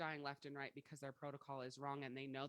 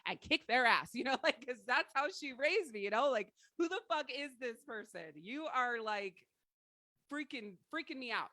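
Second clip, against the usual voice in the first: yelling at mom; 7 semitones higher, 10 dB louder than usual. Retold or imitated. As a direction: shout it like you're shaking her awake. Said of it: "because I'm like".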